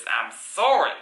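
usual speaking voice

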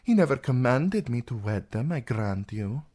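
lightly voiced resentful princess; embittered princess. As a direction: recount a father's slights with mild bitterness. Princess complains about her father.

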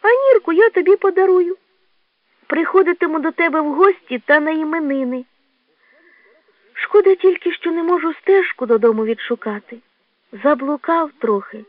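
high, childlike badger voice; wistful little badger. (борсученя, високим голосом)

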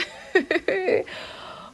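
high-pitched, voiceless giggle; giddy high laugh. Real, not acted. Torn over another great villager.

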